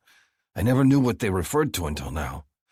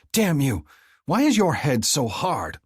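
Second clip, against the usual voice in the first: high, loud pained outburst; angry yell; 7 semitones higher, 4 dB louder than usual. A narrator's reading. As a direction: shout it at him, stung. Heroine yells after hurting hand.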